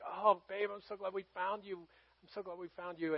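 very soft, relieved murmur; retold hushed reassurance to a child; tender relief. Father reunited with his lost daughter.

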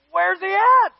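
high, loud baffled question; hammed-up confused outcry; baffled crowd. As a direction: call out in acted bewilderment. Crowd can't find new king.

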